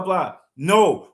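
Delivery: ordinary delivery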